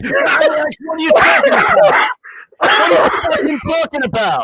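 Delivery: hammed-up outraged yelling, very high; Ryan. Imitating co-host foaming over England, incredulous shouting. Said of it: "Ryan foaming at the mouth".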